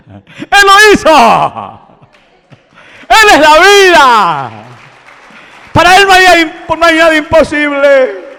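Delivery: proclamación gritada, mucho más aguda; predicación a gritos. Acted. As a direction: predicar a pleno pulmón. (predica a gritos a la congregación)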